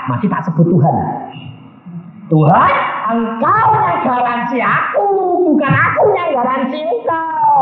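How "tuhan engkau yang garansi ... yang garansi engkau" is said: high-pitched, insistent cry to God; loud retold answer. Preacher answers the questioning voice, insistently. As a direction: re-enact it at a high, forceful pitch. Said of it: "langsung saya jawab".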